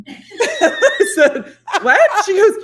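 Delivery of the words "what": high, loud retold "what"; hammed-up startled reaction; mock shock. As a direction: blurt it in laughing disbelief. Acts out shocked reply to technician.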